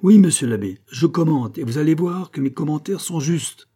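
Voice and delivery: high, loud, defiant retort; proud defiance. A narrator's reading. Pitou answers the priest's mockery, with proud defiance. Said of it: "L'orgueil tendit le ressort".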